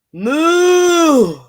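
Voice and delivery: hammed-up storybook cow; loud cow moo. A narrator's reading. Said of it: "That's a little better".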